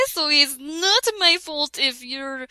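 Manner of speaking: ordinary talk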